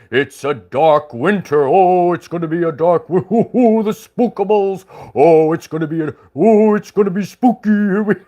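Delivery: lightly voiced spooky doom-sayer; spooky mock voice. Mocking doom-sayers with a playful spooky voice. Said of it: "it's always".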